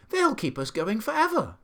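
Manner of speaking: high-pitched, eager monkey voice; monkey's excited cry. A narrator's reading. Monkey marvels at work for window cleaners, excited. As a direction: cry it high, thrilled by the prospect. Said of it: "cried the monkey".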